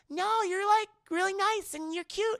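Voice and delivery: put-on very high, girlish voice; high-pitched acted sweetness; imitated sweet girl.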